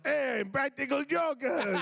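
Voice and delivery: high, slow goofy dub voice; dubbed voice. Retold or imitated. Costa Rica dub voice greeting the Jokers, high and slow. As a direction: call it out like a cheesy dubbed greeting. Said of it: "somebody down there that's like".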